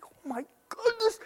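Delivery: awed whispered oh my goodness; stunned whisper. Retold or imitated. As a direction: gasp the words out in a hush. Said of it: "and I'm like".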